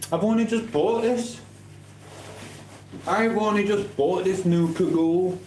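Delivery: high, whiny mock protest; put-on high voice. Answering a viewer who says he needs a new cagoule, in a high mock whine.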